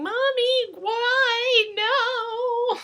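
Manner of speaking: put-on high wailing child's cry; screaming toddler. Teacher imitates a toddler wailing for mommy, high and sing-song. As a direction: wail it like a crying toddler. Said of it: "even though you're screaming".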